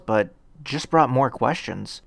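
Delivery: plain reading voice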